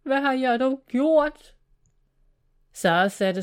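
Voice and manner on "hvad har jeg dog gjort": loud, high cry of despair; anguished outburst, raised in pitch and volume; beside himself. A narrator's reading. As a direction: wail it in self-reproach. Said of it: "Ude af sig selv".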